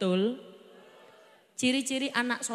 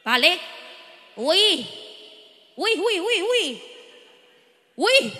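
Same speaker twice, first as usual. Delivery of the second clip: angry father; 3 semitones higher, 6 dB louder, slower than usual. Retold or imitated. Father scolding child.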